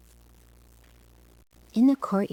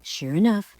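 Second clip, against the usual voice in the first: usual speaking voice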